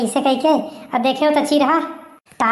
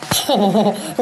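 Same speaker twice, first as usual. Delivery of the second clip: short laugh; 4 semitones lower than usual. Acted. Villager laughs at liquor offer.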